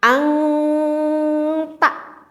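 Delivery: ang ta split, ng at the back; ng then ta. Shown as a demonstration. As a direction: say ang, pause, ta. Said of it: "kalau kita membacanya seperti ini".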